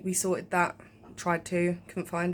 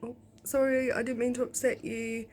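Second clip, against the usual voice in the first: apologetic soothing; 6 semitones higher than usual. Retold or imitated. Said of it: "I was like".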